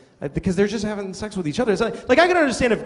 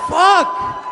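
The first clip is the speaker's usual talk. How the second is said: awed cry; 5 dB louder than usual. Retold or imitated. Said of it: "I started shivering".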